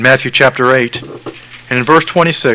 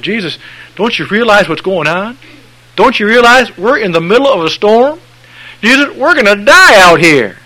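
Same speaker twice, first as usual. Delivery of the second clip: panicked disciples; 8 semitones higher, 5 dB louder than usual. Acted.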